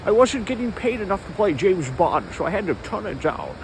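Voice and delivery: Scottish Bond impression; slurred-s Scottish delivery; Sean Connery. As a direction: play a put-on Scottish film star. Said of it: "Sean Connery".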